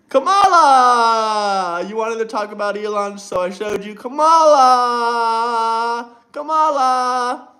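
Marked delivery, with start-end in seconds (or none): 0.1-1.9 drawn out very slowly and louder, like a ringmaster; mock announcement. Streamer to chat, unveiling Kamala with a gloating drawl. Mocking reveal of Kamala clip. 3.9-7.5 mock announcement. Mocking reveal again.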